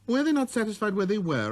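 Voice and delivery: put-on high, light boyish voice; a small boy's clear greeting; little prince voice.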